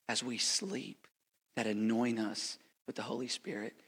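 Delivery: ordinary talk, nothing marked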